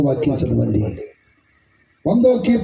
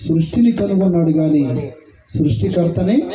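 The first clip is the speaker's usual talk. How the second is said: ordinary delivery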